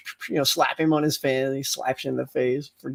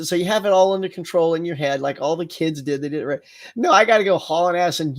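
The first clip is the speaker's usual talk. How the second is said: ordinary delivery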